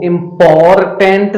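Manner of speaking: इंपॉर्टेंट with every syllable spelled out, R and both Ts sounded; mispronounced. Shown as a demonstration. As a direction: say इंपॉर्टेंट wrongly as im-por-tent, syllable by syllable. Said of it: "नहीं पढ़ना है".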